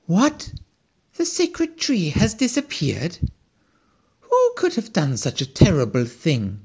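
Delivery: high, startled squirrel cry of disbelief; shocked exclamation. A narrator's reading. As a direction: gasp it out as if the news is a blow. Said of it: "exclaimed the squirrel".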